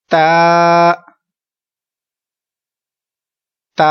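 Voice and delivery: ता held long, not ते; Arabic ta. Shown as a demonstration. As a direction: stretch ता the Arabic way. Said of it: "repeat करते जाइए मेरे पीछे".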